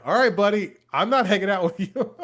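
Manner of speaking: laughing. Retold or imitated. Brushing off the old man.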